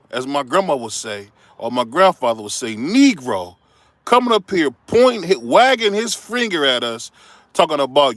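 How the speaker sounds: ordinary talk, nothing marked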